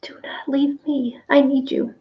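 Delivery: high whispered begging; desperate plea. Retold or imitated. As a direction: whisper it, clinging on for dear life. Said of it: "Or do we say".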